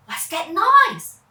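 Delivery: high, slow wondering question; surprised. Acted. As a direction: ask with wide-eyed wonder. Children wonder at sound.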